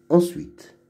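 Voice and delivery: ordinary talk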